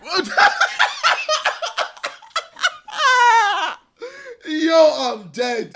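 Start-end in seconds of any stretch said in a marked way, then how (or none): 0.3-3.8 cracking up, can't stop laughing; hysterical laugh. Real, not acted. Friend cracking up at video.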